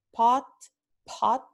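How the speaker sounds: "pot" with an aspirated p; aspirada. Shown as a demonstration. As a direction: puff of air on the p of "pot". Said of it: "es donde tenemos la aspiración". ("pot" con la p aspirada)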